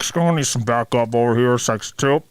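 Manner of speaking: low, muffled walkie-talkie voice; low radio chatter; walkie-talkie call. Retold or imitated. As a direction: imitate a guard radioing for backup. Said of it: "goes down to his walkie".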